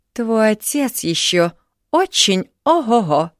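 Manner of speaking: slow, high, loud and smug; with aplomb. A narrator's reading. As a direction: boast it proudly. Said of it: "с апломбом заявила Марина". (с апломбом, самоуверенно)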